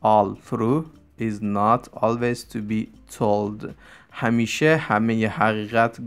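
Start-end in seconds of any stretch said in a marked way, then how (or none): 0.0-3.7 slow model reading. Shown as a demonstration. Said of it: "teacher models proverb twice".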